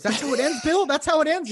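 high, loud mock disbelief; hammed-up outrage at the umpire; incredulous protest. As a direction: squeal it like an outraged coach. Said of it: "Yeah, he said that".

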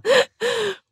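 short burst of very high laughter. Real, not acted. Laughing at the Slytherin song.

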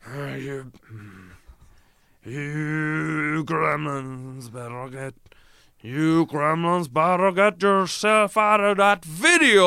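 slow, drawn-out cowboy voice building to a loud, high finish; John Wayne impression. Second take of John Wayne threatening the gremlins. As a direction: drag it out like a Western hero then let rip. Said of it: "doing an impression of John Wayne".

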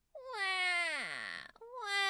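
high mock wailing cries, hammed-up; imitated wailing. Mom imitating her wailing son: high mock crying. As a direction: wail like a sulking boy under a blanket, mock cry. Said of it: "Dying cat sounds faded away".

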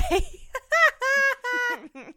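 loud, high burst of laughter. Real, not acted. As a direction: crack up loudly. Big laugh after reading.

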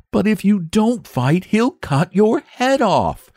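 high, slow, breathless dread; gasping in alarm. A narrator's reading. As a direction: blurt it out in panic. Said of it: "gasped the boy".

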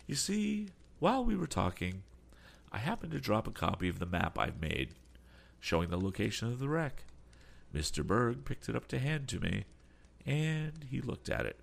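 a lightly voiced, polite old sea captain; courteous old captain. A narrator's reading. Sea captain answers Tom's questions, lightly voiced and polite. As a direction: play the captain, measured and courteous.

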